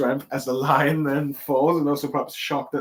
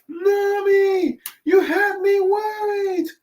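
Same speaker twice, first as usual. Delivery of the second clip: much higher, louder, slow tearful gush; worried Sanji; 4 dB louder, slower than usual. Retold or imitated. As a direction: fret over her like a lovesick cook. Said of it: "Sanji going like".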